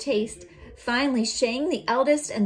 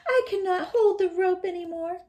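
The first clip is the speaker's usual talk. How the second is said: fake whimper; 10 semitones higher, 3 dB louder than usual. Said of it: "Shang pretended".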